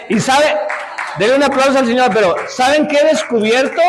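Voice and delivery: bright rallying preacher call; excited call. Real, not acted. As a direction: lift the congregation to applaud. Calls for applause to the Lord.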